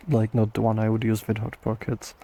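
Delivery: ordinary talk, nothing marked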